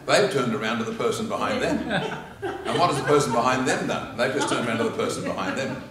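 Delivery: loud amused laughing explanation; laughing while explaining. Real, not acted.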